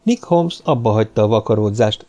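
plain reading voice

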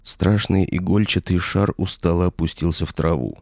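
usual speaking voice

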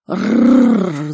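loud rolled animal snarl; long rattling growl; beast growl. Acted. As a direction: growl like a threatened big cat. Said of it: "зарычал Никс".

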